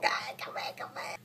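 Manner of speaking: hushed whisper. Real, not acted. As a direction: whisper it urgently. Whispering at camera.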